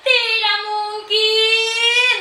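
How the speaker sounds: high, long-held mocking denial; theatrical scoffing. Performer scoffing at being called intellectual, drawn-out high denial. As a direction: stretch it out in mock disbelief.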